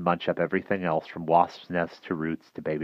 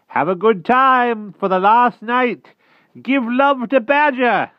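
high, slow, loud farewell call; cheerful call; 10 dB louder, slower than usual. A narrator's reading. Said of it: "called out cheerfully".

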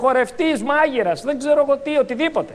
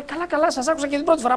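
higher, quick mock-exasperated pupil; 5 semitones higher, faster than usual. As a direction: play a pupil tired of repeated explanations. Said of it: "Η δασκάλα το λέει τρίτη φορά".

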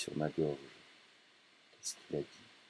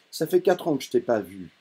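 scornful, raised and louder; contemptuous son, louder and higher; with contempt; 5 semitones higher, 6 dB louder than usual. Acted. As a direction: throw the years back at him with disdain. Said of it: "je dis avec mépris".

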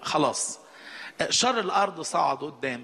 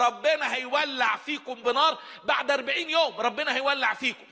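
shouted warning; 7 semitones higher than usual. Retold or imitated. Jonah's judgment message retold.

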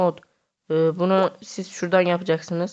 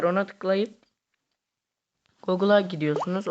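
usual speaking voice

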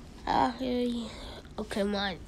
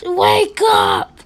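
loud, raised shout; shouted wake-up; 3 semitones higher, 8 dB louder than usual. Pretend. One character rousing a sleeper, loud shout. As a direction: yell to rouse someone.

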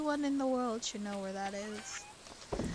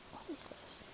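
ordinary talk, nothing marked

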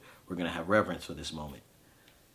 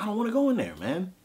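much higher, louder voice nearly cracking; distraught refusal; 5 dB louder than usual. Retold or imitated. At his dying sister's door, crying out much higher he won't go in. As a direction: cry it out like you can't face the room.

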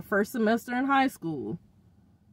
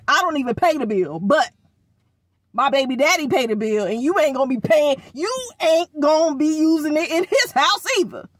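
fast and loud, building to a higher pitch; loud, fast angry lecture to a daughter about the phone; heated scolding; 5 semitones higher, 5 dB louder than usual. Retold or imitated. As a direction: lay down the law on the phone bill. Scolding daughter over phone use.

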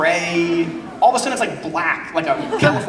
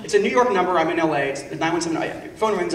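ordinary delivery

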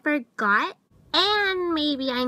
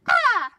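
startled shriek; 7 semitones higher, 5 dB louder than usual. Acted. Shriek at ruined face.

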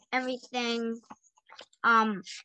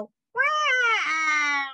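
put-on loud, high, drawn-out Italian-accent wah; 4 semitones higher, 6 dB louder than usual. A narrator's reading. Mario falls from the sky, loud Italian-accent wah. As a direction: whoop it out in a cartoon Italian accent. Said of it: "in an Italian accent".

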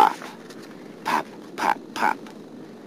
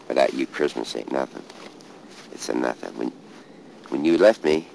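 ordinary talk, nothing marked

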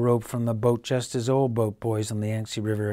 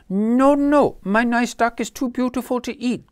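put-on high, louder child's plea; pleading boy; 13 semitones higher, 7 dB louder than usual. Little boy pleading with his parents to spare the duck. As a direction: beg to save the duck. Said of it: "cried the boy".

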